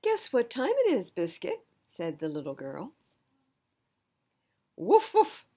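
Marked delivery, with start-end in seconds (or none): 0.0-1.7 put-on high, bright little-girl voice, louder; a child calling her puppy with glee; little girl asking her puppy; excited little girl. Girl character speaks in story. 4.8-5.5 high, loud yappy puppy bark; playful puppy barking; puppy woof. Acted. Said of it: "And Biscuit says".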